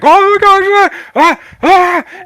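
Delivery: loud, very high screaming crowd; high-pitched mock crowd screams; panicked crowd. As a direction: scream like a startled audience. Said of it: "the crowd immediately begins to".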